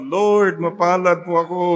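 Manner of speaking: loud loving declaration of thanks. Shown as a demonstration. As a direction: proclaim it lovingly to the whole hall. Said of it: "Louder with love".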